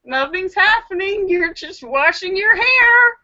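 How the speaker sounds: coaxing self-talk. Retold or imitated. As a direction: talk yourself down gently.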